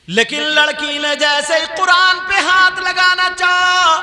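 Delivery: high, loud chanting sermon delivery; high-pitched chanted exhortation; full-force preaching. Acted. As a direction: preach it loudly on a high sustained tone.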